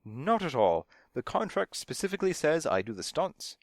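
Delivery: high, heated insistence. A narrator's reading. Stunt double insists on his contract, heatedly. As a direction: object hotly, pitched well up. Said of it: "he said heatedly".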